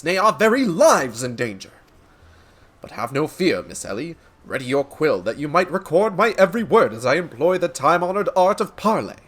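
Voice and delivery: grandiose detective declaiming to an audience; theatrical, florid gentleman sleuth; pompous inspector. Acted. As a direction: proclaim it like a self-important hero. Voices game inspector character.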